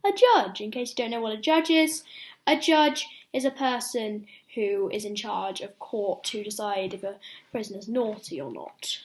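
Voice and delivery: plain reading voice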